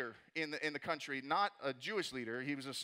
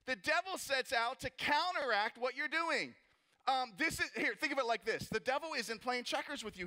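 preaching; 6 semitones higher than usual. Acted. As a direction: preach it out to the congregation. Preaching at full force.

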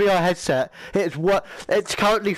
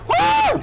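very high, loud whoop; excited whoop; 5 dB louder than usual. Real, not acted. Player hyped for the match, excited whoop. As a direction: whoop with pre-match hype.

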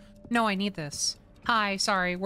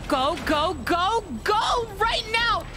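high, urgent yell, rising; panicked urging; 8 semitones higher than usual. Real, not acted. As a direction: push them on in a rising panic.

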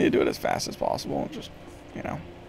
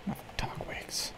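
whispered imitation; 14 dB quieter, slower than usual. Echoes character in whisper.

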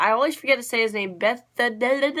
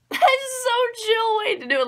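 2 high, laugh-cracked line; 3 squeak it out through giggles; 4 amused shock breaking into laughter; 1 laughing disbelief; 13 semitones higher than usual. Real, not acted. Laughing at Reiner's reveal.

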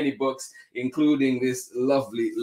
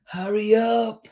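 high, loud teacher's call; hurrying teacher; 11 semitones higher, 5 dB louder than usual. A narrator's reading. Teacher rushing class to assembly, high urgent call. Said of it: "said the teacher".

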